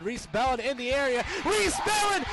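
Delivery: ordinary talk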